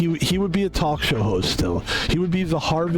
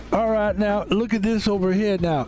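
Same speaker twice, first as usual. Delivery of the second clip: higher, hammed-up host patter; Steve Harvey impression; 6 semitones higher than usual. Host walks around telling jokes, exaggerated showman impression. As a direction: work the crowd like an exaggerated MC. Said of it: "he'll be like".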